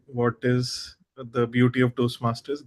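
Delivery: ordinary talk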